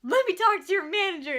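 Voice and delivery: loud indignant demand for the manager. Retold or imitated. Offended customer demanding the manager, loud. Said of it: "And she was like".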